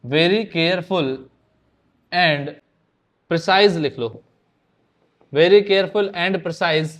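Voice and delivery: each word spaced out and drawn slow for students to write; slow dictation. Shown as a demonstration. Teacher dictating a definition to students, slow and spaced. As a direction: read the answer slowly so the class can copy it down. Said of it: "dictating answer word by word".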